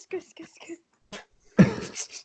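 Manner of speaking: whispered zooming car sound; car whoosh noise. Retold or imitated. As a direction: hiss out a speeding car noise. Imitating cars zooming past.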